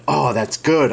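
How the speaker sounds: normal talking voice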